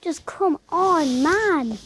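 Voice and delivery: loud fed-up groan of words; exasperated. Real, not acted. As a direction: push her to hurry up. Frustrated urge.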